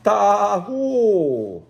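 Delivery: high, loud long calling cry; shepherd's call. Acted. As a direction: call the flock from far across the hills. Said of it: "When Jabal called".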